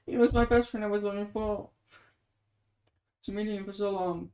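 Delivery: ordinary delivery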